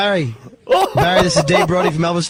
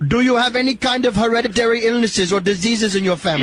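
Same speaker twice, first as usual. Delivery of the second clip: a light, hammed-up Indian accent; put-on Indian accent; 3 semitones higher than usual. Prank caller quizzing the Irishman, in a light mock Indian accent. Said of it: "stupid of an accent".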